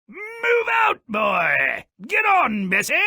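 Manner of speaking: loud, high shouted commands; yelling at the cow. Acted. Jack yelling at his cow to get moving, loud shout. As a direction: bellow at the beast to get moving.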